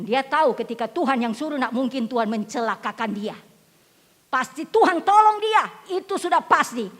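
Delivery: impassioned sermon delivery; full-force preaching. Acted. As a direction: preach it out to the congregation.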